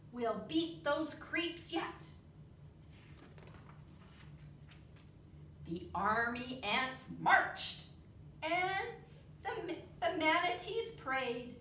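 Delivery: lively read-aloud with big character voices; storybook reading. Acted. Teacher reading a bug story to the children, animated. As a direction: read it like a picture book for the class.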